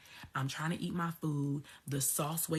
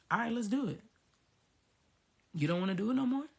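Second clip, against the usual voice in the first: higher-pitched, questioning God impression; 6 semitones higher, faster than usual. God answering her complaint, higher imitated voice. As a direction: play God casually agreeing, pitched up. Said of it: "God was like".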